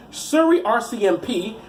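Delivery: usual speaking voice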